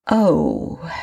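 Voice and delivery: a long sighed "oh"; drawn-out, sighing exhale. A narrator's reading. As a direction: let the word out as a sigh. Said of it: "she sighed".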